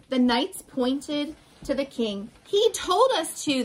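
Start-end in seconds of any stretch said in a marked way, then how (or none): 2.5-3.6 high, defensive storybook cry. A narrator's reading. Knights pointing at the king, high protesting cry. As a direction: pass the blame in a raised pitch. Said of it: "they cried".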